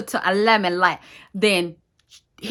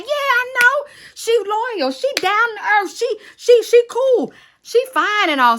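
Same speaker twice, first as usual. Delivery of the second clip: high, loud, eager voice; excited approval; 7 dB louder than usual. Retold or imitated. Relative agreeing about the young woman, excited. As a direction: gush approval in a hammed-up high pitch.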